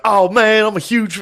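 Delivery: loud, high mock Southern drawl; full Southern accent. Imitating the kid from Bama with edibles, full Southern accent. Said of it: "he had full Southern".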